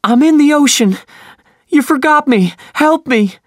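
very high, loud pleading cry; lost boy crying out to the crew. Acted. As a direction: wail for help across the water. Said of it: "he cried".

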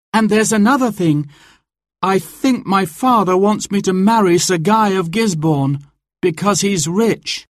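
put-on high, earnest girl's voice; young woman voice. A narrator's reading. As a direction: plead to stay. Marion voiced.

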